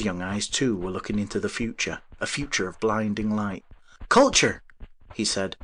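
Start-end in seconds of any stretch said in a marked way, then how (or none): 4.1-4.6 ecstatic. Acted. Inventor's ecstatic vision.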